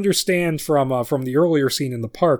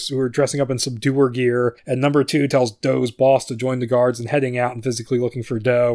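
ordinary talk, nothing marked

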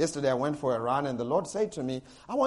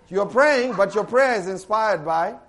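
louder, higher preacher's push; forceful preaching; 4 semitones higher, 6 dB louder than usual. Acted. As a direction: drive the point home from the pulpit.

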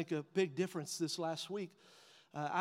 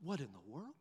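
quiet shock; 7 semitones lower, 11 dB quieter than usual. Retold or imitated. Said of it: "And I'm like".

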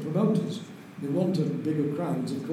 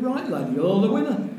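higher-pitched imitation of a Lancashire steward; strong Lancashire accent; 7 semitones higher, 4 dB louder than usual. Chief steward clears his fellow Lancashire lad, strong Lancashire accent. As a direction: deliver the punchline in broad Lancashire. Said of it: "in a strong Lancashire accent".

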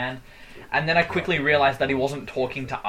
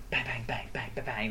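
soft, rapid-fire mock gunshots; imitated pistol sound effects; 3 semitones lower, 11 dB quieter than usual. Retelling the stranger's imaginary pistols, soft sound effects. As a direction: play imaginary pistols under your breath. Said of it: "makes the sound effects".

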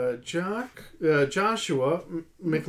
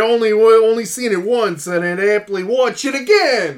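loud, higher-pitched New Yorker; thick New York accent; 6 semitones higher, 9 dB louder than usual. Acted. Hosts trading lines of a listener's comment, thick New York accent. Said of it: "Thick New York accent".

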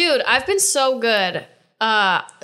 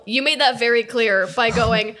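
normal talking voice

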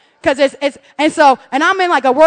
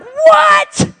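very high, loud shriek; outraged disbelief; 13 semitones higher, 7 dB louder than usual. Retold or imitated. Mom at cash machine, shrieking in disbelief.

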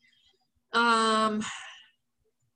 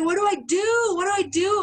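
high, loud mock panic; retelling her own panicked yelling; panicked shout; 8 semitones higher, 4 dB louder, faster than usual. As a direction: shout it like you're frozen in fear. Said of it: "And I was like".

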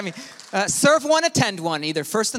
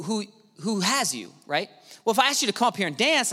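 ordinary talk

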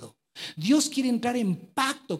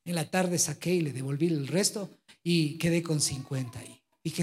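ordinary talk, nothing marked

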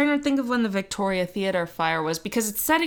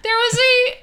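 incredulous; 8 semitones higher, faster than usual. Real, not acted. Incredulous at fireproof magazine.

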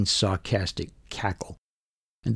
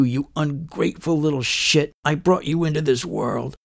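higher-pitched, heated tirade; angry yelling; 6 semitones higher than usual. A narrator's reading. Mother turning on her accusing son, angry yelling. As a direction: lash out in outrage, voice raised high. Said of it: "And she yells at me".

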